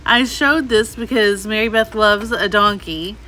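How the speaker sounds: usual speaking voice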